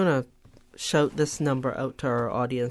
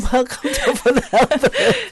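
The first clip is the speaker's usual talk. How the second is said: laughing; 10 semitones higher, 11 dB louder than usual. Real, not acted. Host laughing over name mix-up.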